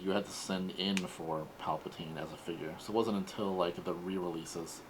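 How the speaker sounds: normal talking voice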